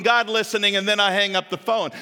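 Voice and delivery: usual speaking voice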